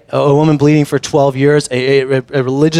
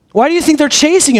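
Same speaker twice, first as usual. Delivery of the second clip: high, incredulous questioning; mock outrage, pitch pushed up; indignant protest; 9 semitones higher than usual. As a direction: demand it in exasperated disbelief. Indignant challenge continues.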